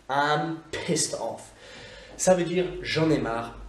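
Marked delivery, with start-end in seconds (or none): 0.1-1.5 slow, calmer repeat at usual level. Shown as a demonstration. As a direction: say it again slowly and evenly. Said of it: "repeat of the expression". (répétition lente et calme)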